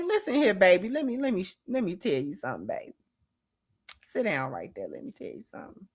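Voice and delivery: put-on hushed mother setting a child straight; Sister Maggie. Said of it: "she got such motherly energy".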